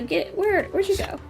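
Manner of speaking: usual speaking voice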